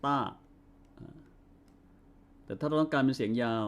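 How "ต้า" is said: ต้า said short and clipped; short ต้า with falling tone; short vowel demo. Shown as a demonstration. Teacher models short ต้า for the class. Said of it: "ออกเสียงสั้นว่า".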